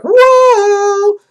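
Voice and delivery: long, very high "woo" sound; loud rising-forest noise held high; imitated whoosh. Vlogger imitating the forest springing out, high loud whoo. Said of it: "it was like".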